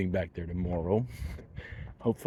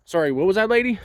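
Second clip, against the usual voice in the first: high mock-innocent question, louder; sarcastic mock-polite; 10 semitones higher, 5 dB louder, faster than usual. Answering the woman who blocked him, mock-polite. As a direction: play dumb with a sarcastic lilt. Retelling his sarcastic reply.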